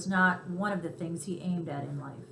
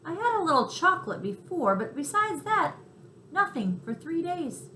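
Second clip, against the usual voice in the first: higher, plaintive little voice; a starving guest confessing; hungry cricket; 9 semitones higher, 3 dB louder than usual. Acted. Chester tells Tucker how long he went without food, higher and plaintive.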